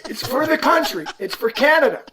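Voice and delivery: high, loud, desperate begging; pleading shout. Retold or imitated. Player begging the rep at three in the morning, high pleading cry. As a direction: beg like it's for the whole nation.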